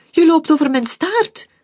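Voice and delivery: high, loud pained cry; shrieking complaint. Acted. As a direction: yell it at the one stepping on your tail. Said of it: "gilde ze".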